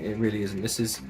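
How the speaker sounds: usual speaking voice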